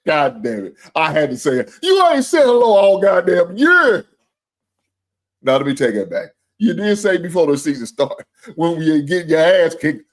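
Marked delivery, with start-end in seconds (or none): 1.8-4.1 high, loud and slow, calling him out; drawn-out indignant shout; loud rant. Real, not acted. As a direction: yell it out at the viewer. Ranting at a viewer.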